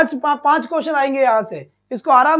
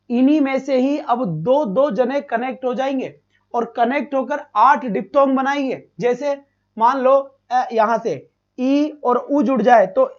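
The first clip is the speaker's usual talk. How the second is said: usual speaking voice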